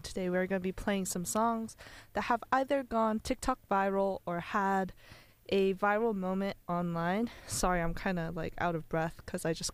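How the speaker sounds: ordinary talk, nothing marked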